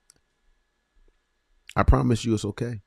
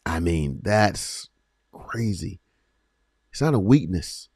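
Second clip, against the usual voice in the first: normal talking voice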